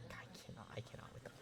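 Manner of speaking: breathy whisper of disbelief; barely-there, defeated whisper; exasperated whisper. Real, not acted. Exasperated at chat.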